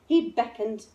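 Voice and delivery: ordinary delivery, nothing marked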